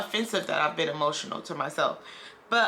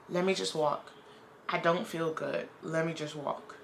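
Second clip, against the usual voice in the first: quiet inner voice; 2 semitones lower, 6 dB quieter than usual. Retold or imitated. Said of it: "I was just like".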